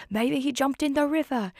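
high-pitched maid voice; imitated maid. Maid guesses to the searching constable, high put-on voice. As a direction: play the maid, pitched well up. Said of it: "the maid is like".

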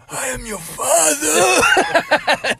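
high, very slow and loud, a hammed-up reveal; mock Vader line. Dying friend jokes a Star Wars reveal, hammed-up. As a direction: drag out a joke movie reveal.

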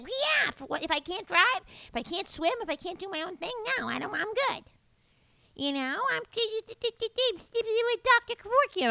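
put-on the mother shrugging off old age; imitating mom. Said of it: "And she's like".

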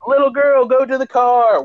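high, insistent woman's voice; hammed-up frantic mom command; mock urgent order. Acted mother ordering girl away.

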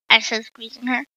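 ordinary delivery, nothing marked

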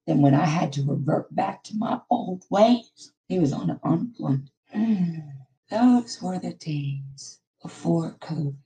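ordinary delivery

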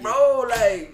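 put-on high, loud Mexican-accented protest; hammed-up Mexican accent, indignant. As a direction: imitate a Mexican gamer defending himself loudly. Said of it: "My accent's not even that strong".

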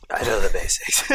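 hoarse Batman voice. Pretend. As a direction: force a hoarse Batman rasp. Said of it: "This is coming in and out".

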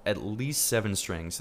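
normal talking voice

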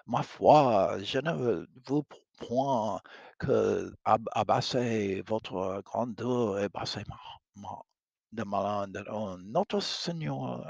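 lightly voiced French-speaking princess, a bit slow. Catherine refusing the king's kiss, lightly French. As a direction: stumble through the French, demure.

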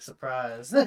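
laughed-out mock surprise; laughing surprise. Telling how he ambushed the enemy, laughing surprise. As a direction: giggle the word out. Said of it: "I was like".

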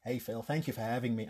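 put-on a light American accent, very soft. Guest greeting the TV host in a light American accent. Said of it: "I'm not gonna do the American accent".

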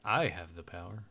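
low, hushed revelation voice; a mock-solemn, deep proclamation; dramatic revelation. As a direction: intone it low and soft, like a revelation. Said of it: "the revelation".

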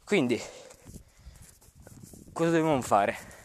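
ordinary delivery

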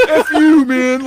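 high, loud imitated yell, laughing; mock yell through laughter. Soaked friend yelling at laughing buddy, mock loud yell. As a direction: bark it at your friend while cracking up. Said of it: "he's yelling at me".

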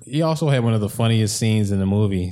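ordinary talk, nothing marked

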